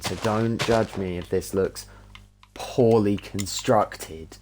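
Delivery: ordinary talk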